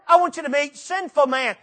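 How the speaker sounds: high, loud, pleading call; voice of Jesus. Acted. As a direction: cry out as Jesus on the cross. Jesus speaking from the cross.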